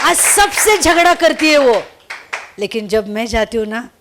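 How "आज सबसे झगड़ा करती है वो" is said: emphatic loud. Real, not acted. Neighbour still fights everyone.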